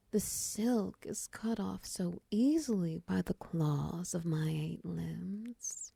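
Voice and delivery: ordinary talk, nothing marked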